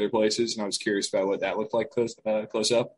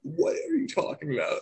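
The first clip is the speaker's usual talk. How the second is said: incredulous. Retold or imitated. Retorting to friend's remark.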